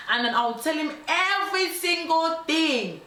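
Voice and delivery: high, emphatic mock threat; hammed-up menacing insistence; retold threat. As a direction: spell out the blackmail with relish. Ex threatening to expose her.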